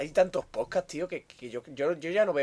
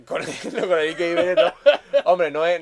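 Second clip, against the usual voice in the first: laughing; 3 semitones higher, 9 dB louder than usual. Real, not acted. Laughing at a rival podcast joke.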